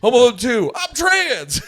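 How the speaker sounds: loud, high joke movie-title shout; mock announcement shout. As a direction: shout it out like a silly sequel title.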